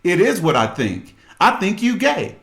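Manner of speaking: high, loud, mocking disbelief; wife scoffing at her husband's denial, loud and sarcastic. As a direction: scoff right back at his excuse. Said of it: "She was like".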